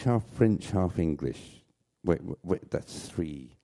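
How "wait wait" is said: normal talking voice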